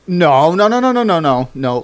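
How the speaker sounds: loud, rising string of noes; emphatic no. Retold or imitated. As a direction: shut it down hard. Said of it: "This is more like".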